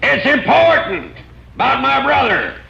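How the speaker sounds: calling up. Acted. Shouting up to the hill.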